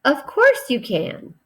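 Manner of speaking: lightly exclaimed. Shown as a demonstration. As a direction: exclaim it warmly to the class. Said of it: "She exclaimed".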